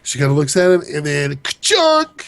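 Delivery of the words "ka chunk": stab sound effect. Retold or imitated.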